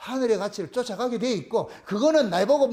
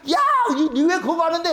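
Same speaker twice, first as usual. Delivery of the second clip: high, loud yell at a friend; acted calling-out; 4 semitones higher, 4 dB louder than usual. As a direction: holler over like a kid butting in. 옆의 철수가 소리쳐 부름. (친구를 부르듯 높게 외치는 소리)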